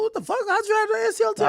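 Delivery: high, stunned what-the-hell; shocked disbelief. Real, not acted. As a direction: blurt it out in total shock. Shocked by friend's injury.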